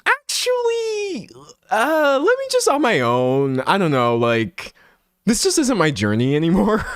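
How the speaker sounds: high, breathy mock of her resigning; prissy imitation of her. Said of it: "she was like".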